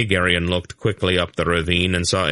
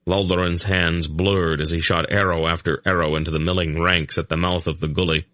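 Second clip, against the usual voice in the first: ordinary delivery, nothing marked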